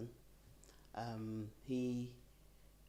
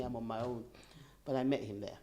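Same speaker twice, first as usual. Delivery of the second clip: ordinary talk, nothing marked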